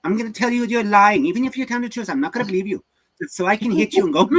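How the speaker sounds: high-pitched hammed-up German accent; old German scientist. Old German scientist threatening a captive in a chair, theatrical German accent. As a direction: play a menacing German interrogator for laughs. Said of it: "like an old German scientist".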